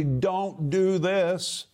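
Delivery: categorical, drawn-out prohibition; emphatic. Retold or imitated. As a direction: stretch out DON'T DO THIS, each word stressed.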